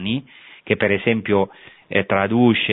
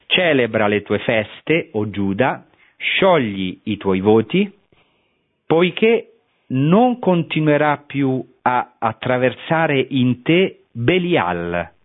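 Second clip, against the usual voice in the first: raised, solemn reading of the prophecy; scripture reading; 4 semitones higher, slower than usual. Acted. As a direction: proclaim the verse slowly, like a lectern reading. Rereads the prophecy aloud. (lettura solenne della profezia)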